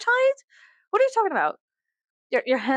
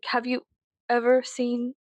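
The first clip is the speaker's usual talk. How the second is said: ordinary talk, nothing marked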